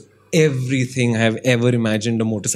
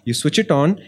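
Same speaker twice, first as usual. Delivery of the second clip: ordinary talk, nothing marked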